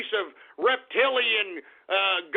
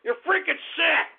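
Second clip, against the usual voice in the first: higher-pitched, disgusted yelling; angry shout; 6 semitones higher than usual. Acted. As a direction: hurl it at the chat. Host enraged by chat shout-outs.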